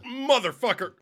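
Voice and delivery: high, loud swearing in pain; pained yell. Real, not acted. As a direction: curse loudly at the burn. Chili burn hits.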